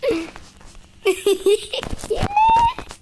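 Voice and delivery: loud high burst of laughter. Real, not acted. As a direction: crack up at your own joke. Laughs at himself. (gargalhada aguda)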